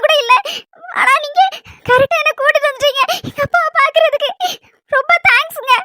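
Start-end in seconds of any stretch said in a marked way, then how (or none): 4.9-5.7 tearful, choked thank you; wife thanking husband while still crying. Acted. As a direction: thank him through tears. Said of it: "thanks சொல்லும் போது கூட நீ அழுவியாம்மா".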